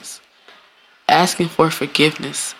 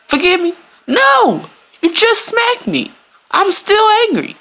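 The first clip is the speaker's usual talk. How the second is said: high mock angry refusal. Smacked person to apologizer, high mock-angry refusal. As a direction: refuse the apology in a shrill huff.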